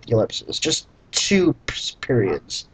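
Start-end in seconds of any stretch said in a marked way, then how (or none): none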